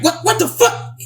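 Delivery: high-pitched, stammered imitation of disbelief; shocked retelling. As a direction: imitate a startled man, voice shooting up. Retold brother walks in shocked.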